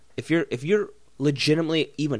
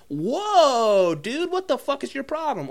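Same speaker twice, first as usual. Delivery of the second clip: shocked outrage; 11 semitones higher, slower than usual. Retold or imitated. Reacting to a fighter punching a baby, high shocked whoa. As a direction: react to something appalling. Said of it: "I'd be like".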